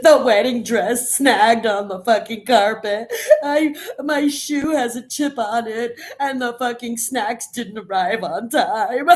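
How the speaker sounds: high, loud, slow, sobbing-laughing bride; upset bride. Retold or imitated. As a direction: wail like a bride whose day is ruined. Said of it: "Then why are you so upset about your wedding?".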